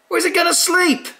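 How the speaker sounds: very high, loud fretting squawk; fussy broomstick. Retold or imitated. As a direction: fret like a prim killjoy. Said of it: "says the Broomstick".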